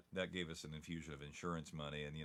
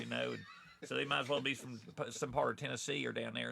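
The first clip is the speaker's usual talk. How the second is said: light Southern Tennessee voice, pitched higher; Tennessee accent; 6 semitones higher, 4 dB louder than usual. Shown as a demonstration. Showing the Tennessee-sounding locals, a light Tennessee accent. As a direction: keep the hint of a Tennessee twang going. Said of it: "some people talk like this".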